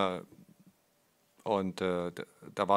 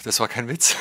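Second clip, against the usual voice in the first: laughing; 3 semitones higher, 9 dB louder than usual. Real, not acted. Ironic remark about a joke.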